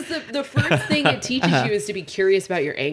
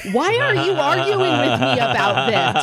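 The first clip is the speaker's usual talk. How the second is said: mock baffled; 11 semitones higher, slower than usual. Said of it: "mid-argument went".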